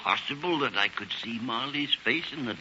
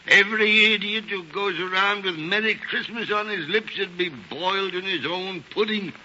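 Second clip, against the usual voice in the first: venomous rant; 8 semitones higher, 3 dB louder than usual. Acted. Scrooge rails against Christmas.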